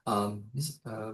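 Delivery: normal talking voice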